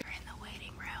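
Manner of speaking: hushed whisper, breath only, no voice. Real, not acted. Vlogger whispering to viewers in the hospital waiting room.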